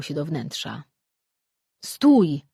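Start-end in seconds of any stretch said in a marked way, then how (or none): 1.8-2.4 loud, sharp snarl of a command; an angry leader snapping at his digger; barked stop. A narrator's reading. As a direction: bark it to halt her at once. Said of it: "warknął Barmonia".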